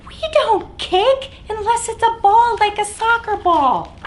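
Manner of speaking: ordinary delivery, nothing marked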